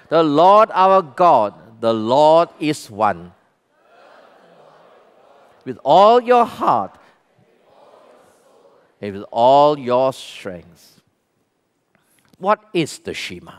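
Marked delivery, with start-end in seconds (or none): 0.1-11.0 slow, solemn recital; reading in unison. Acted. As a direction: recite it slowly with the people. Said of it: "Read it with me".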